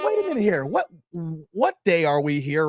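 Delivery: confused surprise at prime-time preseason basketball; surprised double take. Retold or imitated. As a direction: act out catching it on TV in disbelief. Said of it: "I said".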